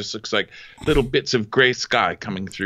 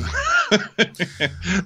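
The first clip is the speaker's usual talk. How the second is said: squeaky burst of laughter; high giggle; 10 semitones higher than usual. Real, not acted.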